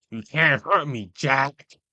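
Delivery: loud, punchy villain impression; Senator Armstrong. Tier-list host quoting the invincible senator, loud Armstrong impression. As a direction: throw it out as a loud, taunting boast. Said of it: "my best, uh, Armstrong impression".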